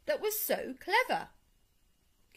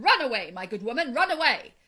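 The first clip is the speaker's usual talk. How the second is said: loud, brisk sergeant's dismissal; shouted order; 3 semitones higher, 12 dB louder than usual. A narrator's reading. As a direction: shoo her off loudly. Sergeant shooing washerwoman in story.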